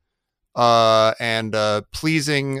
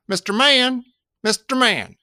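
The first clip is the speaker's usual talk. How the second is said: high, calling out Mister Man; a playful child's cry; mock child call; 8 semitones higher, slower than usual. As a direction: call out like a whiny kid. Performs the chain himself.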